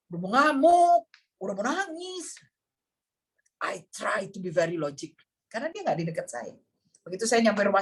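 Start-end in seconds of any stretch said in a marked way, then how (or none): 0.1-2.4 raised pitch, dramatic build; hammed-up inner panic; mock desperation. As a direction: act out being on the verge of bursting. Describing urge to rage and cry.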